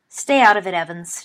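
mild snarl, loud at the start. A narrator's reading. James shutting Evans out of the quarrel, snarling. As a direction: warn her off with a loud first push. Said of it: "James snarled".

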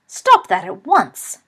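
slow, loud Scottish command; shocked Scottish cry. A narrator's reading. Professor orders Remus to stop, shocked Scottish shout. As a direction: order it stopped, loudly, with shock. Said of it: "cried a shocked Scottish voice".

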